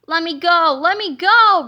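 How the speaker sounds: loud panicked yell, rising; loud frightened roar; terrified roar. A narrator's reading. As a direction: scream for release in terror. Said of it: "roared the terrified Turbo Toilet two thousand".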